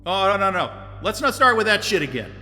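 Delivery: ordinary delivery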